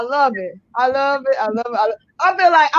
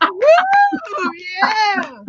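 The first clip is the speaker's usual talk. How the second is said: joyful cheering whoop; real excited whoop; 11 semitones higher than usual. Real, not acted. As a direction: cheer her on with a big woo. Host cheering the guest's story.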